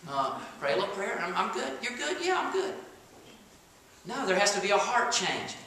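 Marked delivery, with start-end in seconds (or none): 1.2-2.8 flippant voice. Retold or imitated. Imagined flippant convert.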